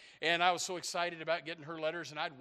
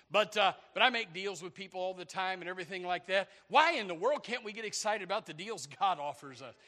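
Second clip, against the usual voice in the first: usual speaking voice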